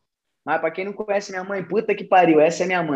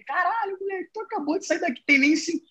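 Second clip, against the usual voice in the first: shocked exclamation; 10 semitones higher, 4 dB quieter than usual. Retold or imitated. Friend shocked on phone.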